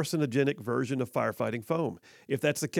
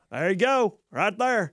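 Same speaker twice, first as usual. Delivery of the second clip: imitated catfish noodler; 6 semitones higher, 3 dB louder, slower than usual. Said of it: "every one of them was like".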